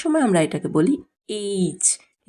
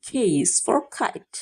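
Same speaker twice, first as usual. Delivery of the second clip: slow drill; 3 semitones higher, 3 dB quieter, much slower than usual. Shown as a demonstration. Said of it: "slow teaching drill".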